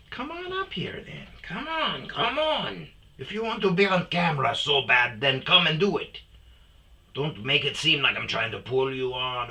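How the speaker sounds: sing-song coax a shy dog onto camera. Real, not acted. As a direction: owner urging his dog Chico to come on camera.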